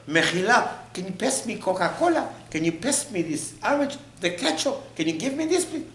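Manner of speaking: high, mock-polite pestering; entitled request. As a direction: rattle off needy requests, pitch climbing. Mocking demands at the table.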